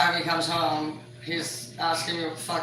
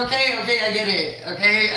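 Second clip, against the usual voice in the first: louder, laughing "Okay, okay"; 3 semitones higher, 5 dB louder than usual. Retold or imitated. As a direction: placate him while laughing. Mom placating son, laughing.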